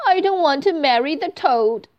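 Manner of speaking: high, loud tearful protest; a touch of tears, pleading; crying girl. Acted. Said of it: "Thumbelina cries".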